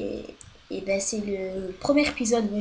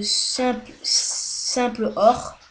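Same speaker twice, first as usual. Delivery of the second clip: drawn out slowly; 4 dB louder, much slower than usual. Real, not acted. Naming the new mod, stretched very slowly. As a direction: stretch simple or out very slowly, no English accent. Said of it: "Je dis pas en accent anglais".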